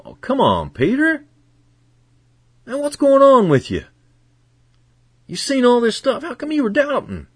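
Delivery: high-pitched, incredulous questioning; exasperated scolding. Acted. Imagined Jesus chiding Peter for doubting, exasperated. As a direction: pitch leaps up in mock exasperation.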